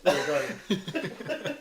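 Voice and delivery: slow words through laughter; laughing. Real, not acted. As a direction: laugh while you say it.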